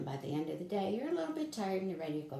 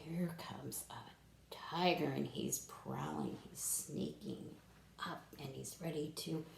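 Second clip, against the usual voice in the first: whisper; 4 semitones lower, 6 dB quieter than usual. Acted. Suspense of sneaking tiger.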